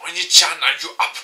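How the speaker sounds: little sister voice. Acted. Little sister waking her big brother, high call. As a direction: sing out Oni-chan like a bratty kid sister.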